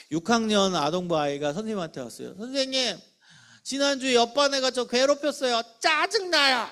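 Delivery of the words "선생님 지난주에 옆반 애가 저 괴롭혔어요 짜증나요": put-on higher, whiny annoyed kid complaining; annoyed child. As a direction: play a sixth grader venting his irritation. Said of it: "이랬어요. 얘는 지금 무슨 감정을 표출했어요". (짜증 난 아이 목소리로 높게 투덜대듯)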